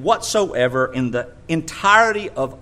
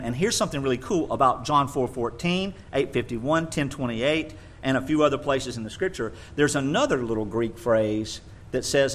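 usual speaking voice

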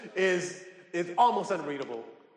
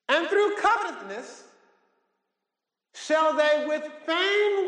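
high, slow declamatory Bible reading; preached scripture reading; 5 semitones higher, slower than usual. Acted. Preacher reading scripture to the congregation, high, slow proclamation. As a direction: proclaim each phrase slowly, pitched up.

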